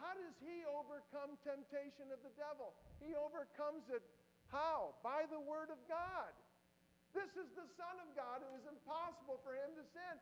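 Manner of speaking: high, loud preacher's climb; full-force preaching. Acted. Preacher rousing the congregation, loud and high. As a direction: build the sermon to a ringing peak.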